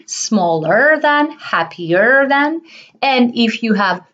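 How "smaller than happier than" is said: slow teaching. Shown as a demonstration.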